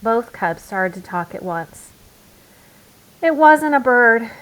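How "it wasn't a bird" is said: high, loud outburst of the truth; blurting confession. Acted. Sister confessing to Mama, blurting it out loud. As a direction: she can't hold the lie in any longer. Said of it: "said Sister".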